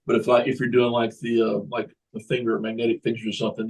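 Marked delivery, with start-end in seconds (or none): none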